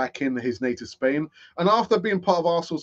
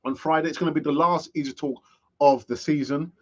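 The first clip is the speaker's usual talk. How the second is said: usual speaking voice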